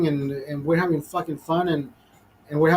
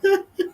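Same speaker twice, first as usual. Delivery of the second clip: high laughing fit; 14 semitones higher than usual. Real, not acted. Telling a buddy his story, a high laughing fit. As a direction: crack up helplessly at the punchline.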